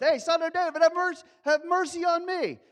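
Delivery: high, loud shouted plea; loud cry for attention. Shown as a demonstration. Pastor demonstrating boao, Bartimaeus crying loud for help. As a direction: cry out so Jesus hears you. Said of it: "cry out with a loud voice".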